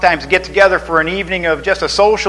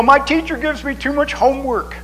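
high whiny schoolkid complaint; whiny child; 10 semitones higher than usual. Retold or imitated. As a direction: whine it like a kid. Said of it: "Children complain".

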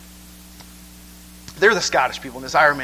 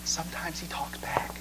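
whisper; 11 dB quieter than usual. Real, not acted. Emphatic aside in sermon.

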